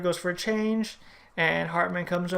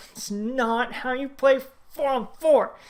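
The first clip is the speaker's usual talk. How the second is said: louder, higher, slower exasperated outburst; 6 semitones higher, 5 dB louder, slower than usual. Real, not acted. Fan venting at his team's four-on-four play, louder and higher. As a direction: vent your frustration, higher and slower.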